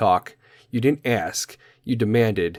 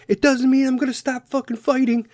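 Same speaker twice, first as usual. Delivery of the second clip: determined man. Retold or imitated.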